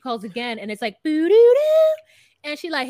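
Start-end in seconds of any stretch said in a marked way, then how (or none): 1.0-1.9 high, loud sing-song tone imitation; mock phone error tone. Retelling the failed call, mimicked phone tone.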